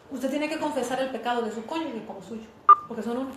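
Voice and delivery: ordinary talk, nothing marked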